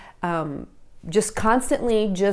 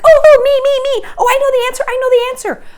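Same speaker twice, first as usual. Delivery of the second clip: much higher and louder, frantic me me me; eager game show contestant; 9 dB louder, faster than usual. Retold or imitated. Parent mocking herself as game show contestant, squealing. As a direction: bounce like a contestant desperate to buzz in. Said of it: "jumping in like you're, uh, in a game show".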